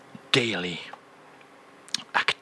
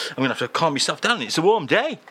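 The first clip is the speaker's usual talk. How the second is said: normal talking voice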